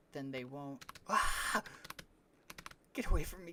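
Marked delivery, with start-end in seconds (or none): 1.1-1.6 loud sudden unvoiced yelp of alarm; startled yelp. Real, not acted. Startled by a mob, a loud yelp. 2.9-3.5 alarmed shout. Real, not acted. Mob attacks in the game.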